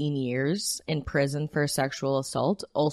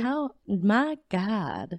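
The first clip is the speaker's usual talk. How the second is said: golden age film star; 6 semitones higher, much slower than usual. Acted. Trying old-time voice.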